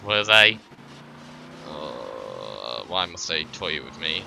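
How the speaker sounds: ordinary delivery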